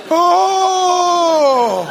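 high drawn-out group gasp of dismay; long shocked "ohh". Acted. Congregation reacting to the guest speaker, mock horror. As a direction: hold a mock horrified "ohh".

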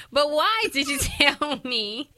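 high, loud protest broken by a laugh; laughing mock demand. Real, not acted. Daughter asking her mother why, laughing mock exasperation. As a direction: press the question while cracking up.

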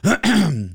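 loud throat clear. Real, not acted. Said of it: "I'm choking on, um, saliva here".

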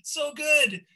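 high, loud mock rapture; imitating the crowd's enthusiasm; mock crowd praise. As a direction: gush like the adoring crowd. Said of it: "everyone would be like".

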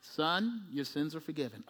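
low, soft, measured pronouncement; calm Jesus. Acted. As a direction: answer the commotion gently and quietly. Jesus calmly answers frantic friends.

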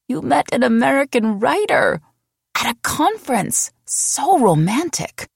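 put-on high, excited girlfriend voice, slowing on the last words; gushing friend. Helsinki friend gushing to her about the American writer, high and excited. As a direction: swoon over the news like a starry-eyed friend.

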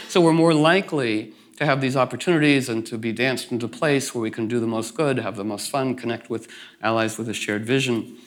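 ordinary talk

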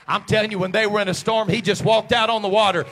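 loud shout climbing in pitch; full-force preaching. Real, not acted. Preacher proclaiming God in the storm, building shout.

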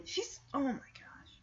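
mock horrified whisper; hushed shock. Shadow learns his friend is still inside, hushed shock. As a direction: drop to a whispered gasp of dismay.

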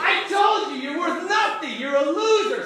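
high, loud jeering devil; accusing devil. Acted. Devil accusing someone once the deed is done, jeering. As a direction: turn on them with a loud, high taunt. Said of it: "And then the devil's like".

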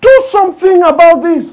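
loud, high frustrated demand; exasperated demand. Retold or imitated. Listener demanding Jesus act, loud and high. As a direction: throw it out in frustration.

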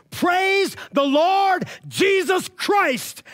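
very high, slow, loud shout; slow ringing shout of praise; yelled at full lungs. Retold or imitated. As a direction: cry it out at the top of your lungs. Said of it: "He yelled from the top of his lungs".

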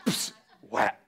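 breathy scoff, then a whispered slap noise; mock kid's scoff and slap. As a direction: mimic a sulky kid's hiss. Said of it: "It was just a sound".

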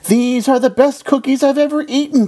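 high-pitched storybook delight; delighted praise. A narrator's reading. Frog tastes Toad's cookies, high and delighted. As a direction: rave to a friend in a high voice.

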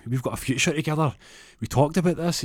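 acted-out desperate boyfriend; pleading. Pleads with girlfriend.

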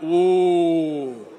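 a drawn-out pained "Ooh" from the room; sympathetic ooh. Retold or imitated. Ladies in the room reacting to the cut, a sympathetic ooh. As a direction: groan along like a crowd that feels the loss. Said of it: "they're like".

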